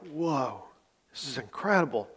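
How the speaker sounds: soft, amazed murmur; a crowd's quiet, amazed reaction; hushed awe. Retold or imitated. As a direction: breathe it out in wonder. Crowd amazed by Jesus' words.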